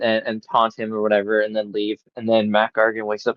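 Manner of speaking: normal talking voice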